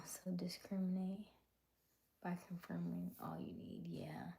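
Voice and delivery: soft whispered advice. Real, not acted. Whispering advice to viewers about information. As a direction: breathe it out gently.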